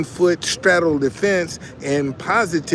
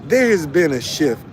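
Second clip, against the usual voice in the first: ordinary talk, nothing marked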